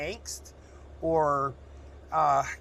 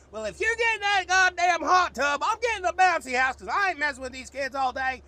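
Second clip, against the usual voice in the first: much higher, loud nagging wife voice; exasperated wife; 12 semitones higher, 4 dB louder than usual. Retold or imitated. Wife cutting in about the hot tub, high and loud. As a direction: chime in with a fed-up, shrill-free squeal of complaint. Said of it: "then the wife pipes in and goes".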